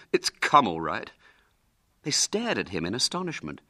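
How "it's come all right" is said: put-on mildly bitter, rueful boy; a touch of bitterness. William repeating his bad news to friends, bitterly. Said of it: "said William bitterly".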